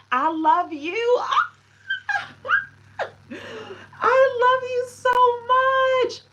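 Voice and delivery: very high, loud, slow squealed thanks; gushing squeal. Real, not acted. Streamer gushing at viewers who sent gifts, squealing. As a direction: squeal it out with delight and a laugh.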